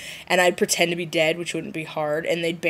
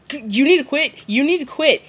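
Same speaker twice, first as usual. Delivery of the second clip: high, loud repeated warning; mock stern warning; 7 semitones higher, 5 dB louder than usual. The Volturi warning Tech, loud mock stern warning. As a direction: warn her twice, pretend stern. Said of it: "They'd be like".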